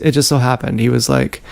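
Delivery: ordinary delivery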